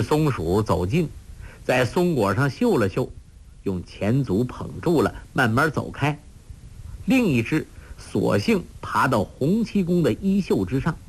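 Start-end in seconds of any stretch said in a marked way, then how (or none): none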